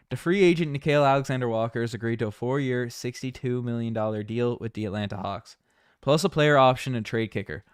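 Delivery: mock report, put-on Newfoundland accent. Streamer reporting a free agent deal, Newfoundland accent. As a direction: read the news in a Newfoundland accent. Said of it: "I'll start talking in a Newfoundland accent".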